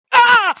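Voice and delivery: loud shrieking jump scare scream. Real, not acted. Player caught by the ghost, sudden shriek. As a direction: shriek in pure fright.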